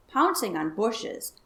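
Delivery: usual speaking voice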